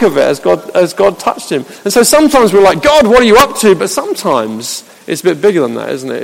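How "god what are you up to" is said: high, lightly comic appeal to God; raised-pitch mock complaint; humorous exasperation. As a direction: ask it in playful bafflement. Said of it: "It's not the humorous".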